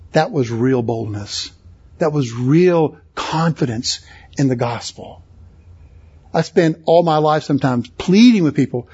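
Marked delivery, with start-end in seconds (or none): none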